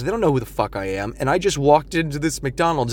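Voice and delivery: ordinary talk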